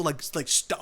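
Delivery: ordinary talk, nothing marked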